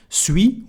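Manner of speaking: "Suis" with no S at the end; "Suis" ending on the ee vowel; silent final S. Shown as a demonstration. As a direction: leave the last S of "Suis" silent. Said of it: "we don't pronounce the last S".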